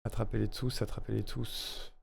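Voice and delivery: very soft, low-key run-through; under the breath. Real, not acted. Streamer testing the Pokérap slogan to themself, very softly. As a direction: murmur the slogan to yourself.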